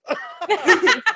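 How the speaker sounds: loud high burst of laughter. Real, not acted. Speaker cracking up after quoting friends' insult. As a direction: crack up at your own punchline.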